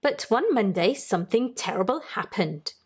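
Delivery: plain reading voice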